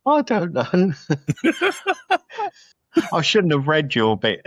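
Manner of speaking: high, laughing through the words; cracking up, barely able to get it out; real laughing fit. Real, not acted. Podcaster asked for the synopsis, helpless with laughter. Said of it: "I've got to stop laughing".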